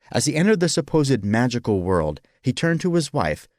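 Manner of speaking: plain reading voice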